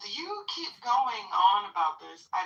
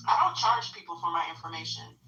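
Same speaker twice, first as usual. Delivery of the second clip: normal talking voice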